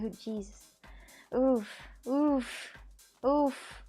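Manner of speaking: ordinary talk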